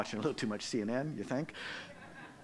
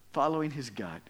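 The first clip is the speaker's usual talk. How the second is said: ordinary delivery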